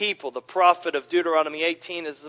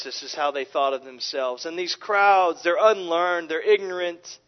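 ordinary delivery

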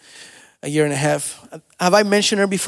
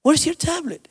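distract a little one gently, pitch up; coaxing a toddler; 9 semitones higher than usual. Retold or imitated. Dad distracting upset toddler.

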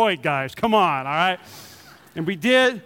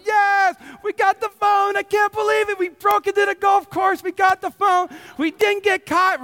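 jubilant celebration; 12 semitones higher than usual. Retold or imitated. Couple finds the lost phone on golf course, jubilant. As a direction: whoop it up like a hard-won victory.

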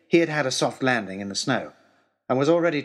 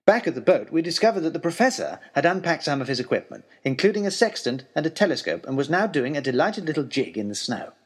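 ordinary delivery, nothing marked

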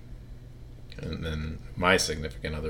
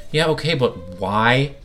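dismissive interviewer; 6 semitones higher, 8 dB louder, slower than usual. Retold or imitated. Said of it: "Bryant Gumbel would be like".

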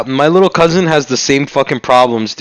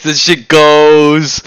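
drawn-out slow; 3 semitones lower, much slower than usual. Shown as a demonstration. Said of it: "planting words in people's brains".

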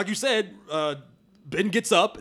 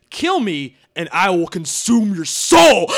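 menacing villain; 3 semitones lower, 7 dB louder, slower than usual. Shown as a demonstration. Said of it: "That is not the same thing as".

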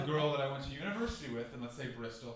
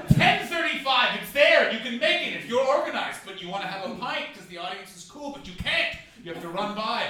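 frantic rush; 6 semitones higher, 10 dB louder than usual. Retold or imitated. Rushing for the late train.

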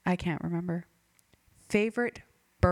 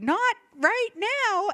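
mock promo code; 12 semitones higher, 5 dB louder, much slower than usual. Joke offer code in ad.

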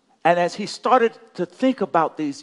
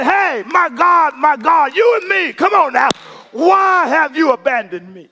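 acted cry to God in a raised preacher's voice; Jesus; 8 semitones higher, 7 dB louder than usual. Preacher voicing Jesus praying from the cross, impassioned crying out. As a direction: cry it out, pleading and insistent. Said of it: "he just cries out to God".

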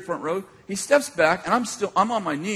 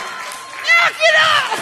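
very high, drawn-out mock shriek; panicked squeal; 6 dB louder, much slower than usual. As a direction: squeal it comically, stretched out in terror. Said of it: "I think it was more like".

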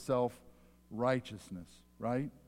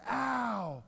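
mock scream; 10 semitones higher than usual. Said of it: "he screams at the top of his voice".

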